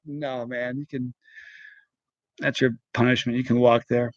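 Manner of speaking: low, soft deadpan refusal; low-key refusal. Retold or imitated. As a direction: turn him down quietly, pitch dropped. Acted refusal to the stranded guy.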